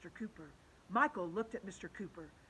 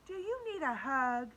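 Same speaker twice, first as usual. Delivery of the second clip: lightly voiced goody-goody girl; sweet girl voice; 5 semitones higher than usual. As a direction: offer it sweetly like a teacher's pet. Andrea offers teacher a hug.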